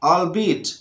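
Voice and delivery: usual speaking voice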